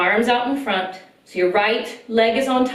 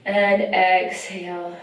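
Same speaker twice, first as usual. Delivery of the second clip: slow, calm breath guidance; yoga breath cue; slower than usual. Acted. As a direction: draw out the exhale gently. Guides the breathing.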